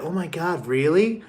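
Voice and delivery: lightly voiced shocked girls; mock gullible girls. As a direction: imitate wide-eyed believers. Girls believing the story.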